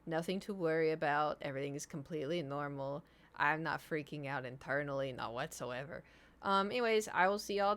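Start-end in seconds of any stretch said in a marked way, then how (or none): 0.1-6.0 low reassurance, sinking softer; feigned calm. Real, not acted. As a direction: sink lower and quieter while denying panic. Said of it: "I'm nervous".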